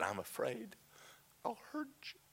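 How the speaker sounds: very soft, faltering admission; hushed, voice lifting at the end; fearful confession. Retold or imitated. Confessing fear to God.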